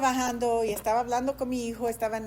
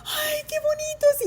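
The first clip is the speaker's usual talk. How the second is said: much higher squeal of delight; delighted coo; 5 dB louder than usual. Retold or imitated. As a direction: gush over the puppies. Ternura ante los perritos. (chillido tierno de alegría, mucho más agudo)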